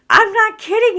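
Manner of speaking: loud, high-pitched insistence; emphatic insistence. Real, not acted. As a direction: burst out high and loud, insisting it's true. Insists husband really cried.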